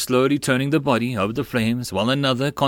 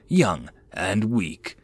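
plain reading voice

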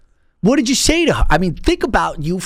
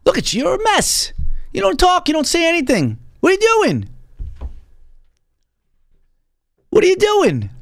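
pitched way up, scolding a hopeless guest; mock exasperated scolding; 14 semitones higher than usual. Host scolds the guest who won't talk, in a high mock-exasperated squeal. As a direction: squeal it out like you can't believe this mess.

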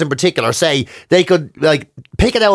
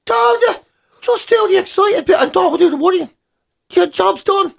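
much higher voice, mocking the advice; squeaky patronising know-it-all; mimicking a glib adviser, high pitched; 14 semitones higher than usual. Mocking dismissive advice-giver.

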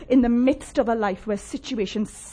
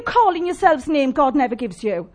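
loud, high rallying preaching; rally the congregation to rise; fiery revolutionary speech; 4 semitones higher, 5 dB louder than usual. Acted. Preaching climax, rise up call.

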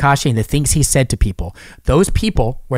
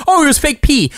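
mocking high voice; 10 semitones higher than usual. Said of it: "You're gonna say it".

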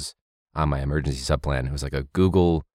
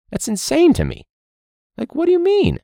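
disbelief; 3 dB louder than usual. Real, not acted. Teacher ranting about audacity.

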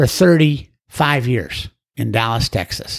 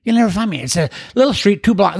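put-on higher, frantic voice of a drunk man on the edge; desperate caller; 8 semitones higher than usual. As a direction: sound hopeless and panicky. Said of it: "He said".

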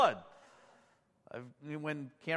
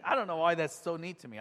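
usual speaking voice

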